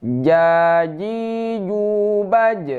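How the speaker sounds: "jaji jubaj" chanted with hard, airless j sounds; J without air. Shown as a demonstration. Teacher reads jim for students, chanting "jaji jubaj" with airless j. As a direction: hold the long vowels high, no puff on the j in "jaji jubaj". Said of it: "Tidak ada angin".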